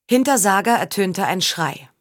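plain reading voice

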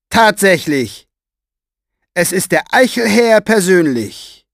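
strained, pressed voice, high and loud. Acted. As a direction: squeeze the words out, mocking from above. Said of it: "Seine gepresste Stimme trug weit".

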